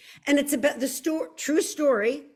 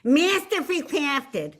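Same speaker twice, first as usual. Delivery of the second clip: exaggerated South Philly accent, higher and drawn out; 5 semitones higher, 3 dB louder, slower than usual. Shown as a demonstration. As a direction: lay the South Philly on thick, slowly. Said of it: "my South Philly is coming to the fore".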